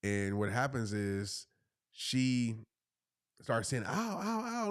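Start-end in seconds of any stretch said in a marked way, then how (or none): 3.9-4.7 high mock cries of pain; retold freak-out. Wife bitten by fire ants, imitated yelps of pain. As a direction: imitate her panicked ows, pitched up. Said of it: "So she's freaking out".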